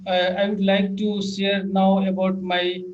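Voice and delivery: ordinary talk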